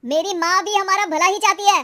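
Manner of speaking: angry shouting. Acted. Husband defends his mother to wife, shouting. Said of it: "चिल्ला क्यों रहे हो".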